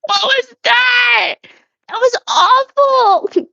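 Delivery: very high, drawn-out squeal; not her own voice. Real, not acted. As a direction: wail it high and slow in mock horror. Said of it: "That is not my voice".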